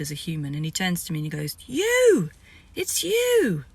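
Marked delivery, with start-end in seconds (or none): 1.7-3.6 high, loud cry of recognition; excited recognition. Retold or imitated. The chief cries out in awe at seeing her, high and loud. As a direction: yelp it out, much higher.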